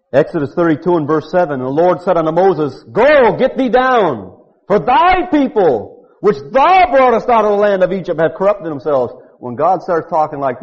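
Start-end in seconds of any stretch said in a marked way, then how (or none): 1.6-2.8 high, slow thundering reading; preacher voicing God's anger to Moses; scripture reading as God. 2.8-8.2 high, slow, loud rebuke; God's anger. Acted. God's angry words read. 8.2-9.1 high, slow thundering reading; preacher voicing God's anger to Moses; scripture reading as God.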